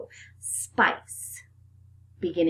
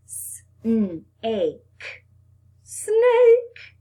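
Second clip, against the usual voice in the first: Snake squealed very high and loud; 4 semitones higher, 8 dB louder than usual. Shown as a demonstration. Teacher blends the sounds into Snake, very high and loud. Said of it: "say it fast".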